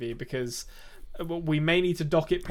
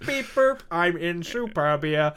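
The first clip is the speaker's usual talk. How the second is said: robot voice; 3 semitones higher, slower than usual. Pretend. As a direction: play a robot, slow and mechanical.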